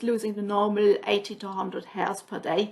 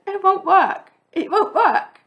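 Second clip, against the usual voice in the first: high, louder giggly refusal; telling the physio it won't work, laughing; laughing protest; 9 semitones higher, 6 dB louder, slower than usual. Retold or imitated.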